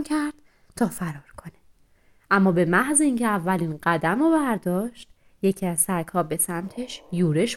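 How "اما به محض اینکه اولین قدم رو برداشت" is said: plain reading voice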